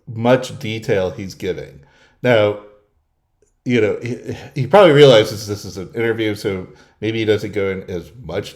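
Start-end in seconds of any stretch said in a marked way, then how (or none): none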